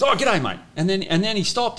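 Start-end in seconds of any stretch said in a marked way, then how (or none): 0.0-0.6 coach greeting. Retold or imitated. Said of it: "and he goes".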